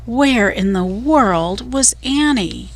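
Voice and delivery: ordinary delivery, nothing marked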